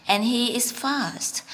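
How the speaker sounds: ordinary delivery, nothing marked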